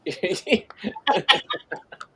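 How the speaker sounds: high, breathy-voiced burst of laughter; real laugh. Real, not acted. Co-host says he'll complain about it, amused laughing.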